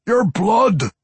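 high, loud dwarf vampire; tormented dwarf craving blood; Pwent. A narrator's reading. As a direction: cry it out with hunger. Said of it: "Pwent explained".

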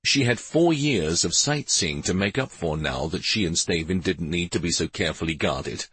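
usual speaking voice

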